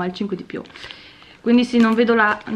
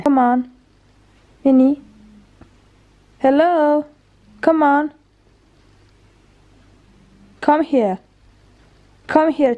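high, loud calling to a dog; talking to a dog; 4 semitones higher, 5 dB louder, slower than usual. Real, not acted. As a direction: call the dog over eagerly.

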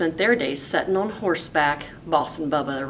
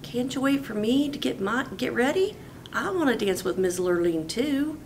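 pleading Bubba; 6 semitones higher than usual. Acted. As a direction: beg them to wait for him. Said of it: "Bubba asked".

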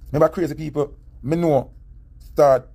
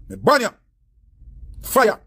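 shouted ban; 8 semitones higher, slower than usual. Real, not acted. Ranting ban on the artist.

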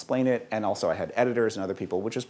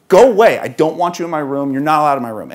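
angry yell; 3 semitones higher, 9 dB louder than usual. Acted. Hurt son yelling at his father to leave, angry.

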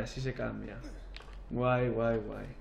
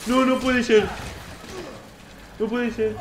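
high, loud, frantic disbelief; panicked shouting; 10 semitones higher, 8 dB louder than usual. Real, not acted. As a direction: shout in alarm as the threat returns. Attacked again, disbelief. (gritos agudos de pánico e incredulidad)

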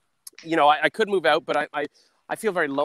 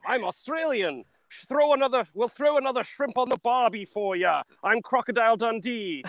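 put-on higher-pitched amateur Australian accent; 6 semitones higher, 3 dB quieter than usual. Comedian tries to be like Hugh Jackman, Australian accent. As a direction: have a go at a hammy Australian accent. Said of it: "That's my Australian accent".

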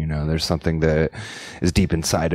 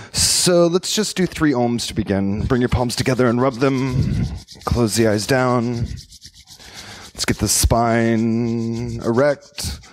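guided meditation; 5 semitones higher than usual. Acted. Yoga teacher settling the class before three oms, guided meditation.

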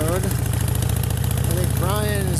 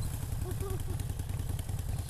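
airy laugh; 10 dB quieter than usual. Real, not acted. Laughing at the river crossings.

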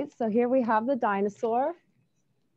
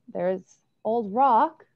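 ordinary delivery, nothing marked

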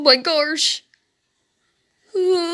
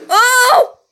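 loud frightened cry with a laugh; startled scream; 7 semitones higher, 9 dB louder than usual. Real, not acted. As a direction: jump out of your skin. Reacting to jump scare.

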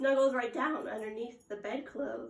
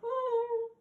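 sharp mock gasp of surprise; 10 semitones higher than usual. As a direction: gasp as if you just caught a mistake. Teacher feigns surprise at mistake.

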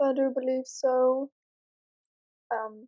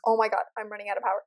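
ordinary talk, nothing marked

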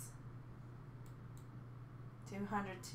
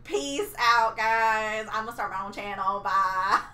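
loud mock exit; 10 dB louder than usual. Dramatic mock walk-out.

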